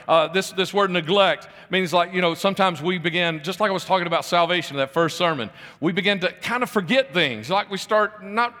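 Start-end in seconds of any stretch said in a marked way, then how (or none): none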